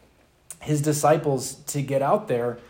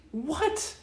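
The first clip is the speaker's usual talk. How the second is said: mock disbelief; 13 semitones higher, 6 dB quieter than usual. Mock shock at the servant.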